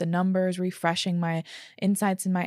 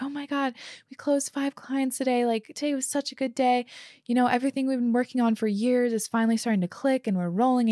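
retold in a higher, bright-eyed rush; a touch of his thrilled voice, building the good news; jazzed husband; 5 semitones higher than usual. Husband telling his wife about closing five clients, lightly excited. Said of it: "so jazzed and excited and just happy".

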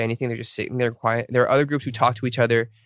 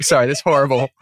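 ordinary talk, nothing marked

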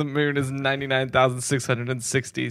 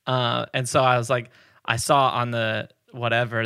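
ordinary talk, nothing marked

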